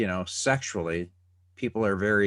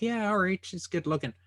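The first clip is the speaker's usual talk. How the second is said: high, impressed guy's reaction; approving guy; 10 semitones higher than usual. Retold or imitated. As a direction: voice a guy checking her out. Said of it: "it's like".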